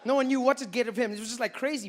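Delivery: ordinary talk, nothing marked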